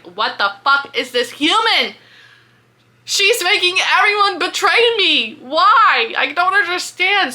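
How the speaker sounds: high and loud, exasperated yelling; a prince losing his temper completely; furious rant. Retold or imitated. Prince Mandex ranting at Callie, high loud fury.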